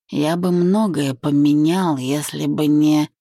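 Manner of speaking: ordinary delivery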